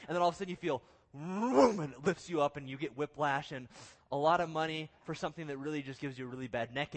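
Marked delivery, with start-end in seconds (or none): 1.1-1.8 loud, rising imitated whoosh; big vocal yank of the cord; vroom sound effect. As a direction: swoop the noise up high and loud. Imitates the cord yanking him up.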